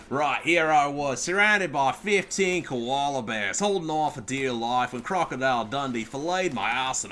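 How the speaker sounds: loud, drawn-out Aussie storyteller; mock Australian accent. Streamer mocking opponent from down under, hammed-up Australian accent. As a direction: spin a tall tale in a hammed-up Australian accent.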